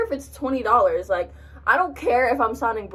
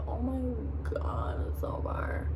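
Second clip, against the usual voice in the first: whispered, much softer moan about the distance; whispered complaint; 10 dB quieter than usual. Retold or imitated. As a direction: whisper "Oh my God, it's so far" like a tired groan. Dreading long trip to party.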